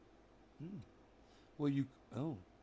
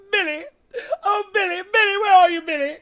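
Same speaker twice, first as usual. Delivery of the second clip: high, loud wailing call for Billy; 22 dB louder, faster than usual. Pretend. Summoning Billy with a loud, high wail. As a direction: cry out his name, way up high and loud.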